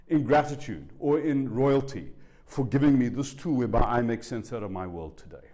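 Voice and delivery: usual speaking voice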